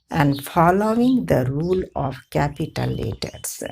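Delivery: ordinary talk, nothing marked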